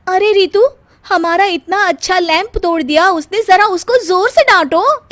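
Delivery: high and loud, indignant; daughter-in-law telling sister-in-law to scold her son; indignant complaint. Acted. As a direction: demand the child be scolded. Hina complains about broken lamp.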